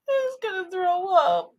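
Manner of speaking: high, slow whiny sob; nauseous crying. Retold or imitated. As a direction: whimper it, about to be sick. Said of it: "I was like".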